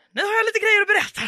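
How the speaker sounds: high, loud and fast eager news; excited announcement. Retold or imitated. To her roommates, bursting with excited news. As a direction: burst in with gossip.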